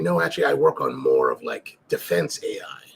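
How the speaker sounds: low, deadpan impression of the AI engineer; driver imitation. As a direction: drop way down to play the earnest driver. Driver quoted in retold chat.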